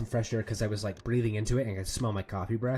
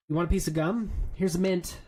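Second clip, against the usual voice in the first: mock polite offer; 7 semitones higher, 7 dB louder than usual. Politely offering gum to bad breath.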